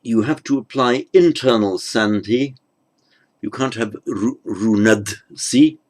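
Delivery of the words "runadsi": "runadsi" drawn out slowly as the form to avoid; slow wrong form. Shown as a demonstration. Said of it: "That has to become runatsi".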